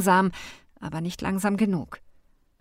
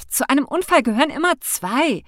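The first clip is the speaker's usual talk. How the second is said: snapped, higher and louder; indignant retort, raised voice; hissing back; 7 semitones higher, 5 dB louder than usual. A narrator's reading. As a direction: fire it back at him. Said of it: "fauchte ich deshalb zurück".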